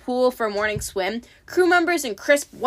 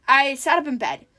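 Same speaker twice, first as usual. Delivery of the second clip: ordinary delivery, nothing marked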